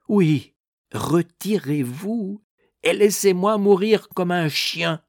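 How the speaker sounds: high, slow, strained demand; bitter, high-pitched protest; exasperated dismissal. A narrator's reading. Dying man dismisses clergymen.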